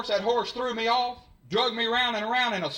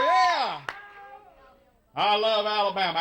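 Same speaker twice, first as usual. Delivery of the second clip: normal talking voice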